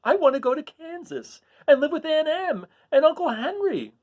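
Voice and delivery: put-on high, pleading little girl voice; a child longing for home; Dorothy crying out. Dorothy begging to go home to Kansas. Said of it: "cried Dorothy".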